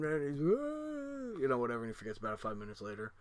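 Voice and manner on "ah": high, soft whine; imitated kid's wail; long high-pitched mock whimper; mock crying wail. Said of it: "he's crying".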